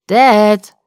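loud, drawn-out call; loud shout to someone indoors. A narrator's reading. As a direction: call through the house to your father. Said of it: "rief er".

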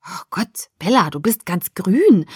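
hushed start, jumping up at the end; alarmed, uneasy classmate; nervous. A narrator's reading. Boy seeing his friend turn green, nervous. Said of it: "sagte Mike nervös".

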